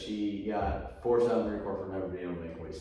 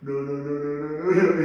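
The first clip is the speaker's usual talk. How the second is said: high panicked protest that grows louder; rising panic; 6 semitones higher, 9 dB louder than usual. Retold or imitated. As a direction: beg your way out in a rising panic. Panicked refusal of partner.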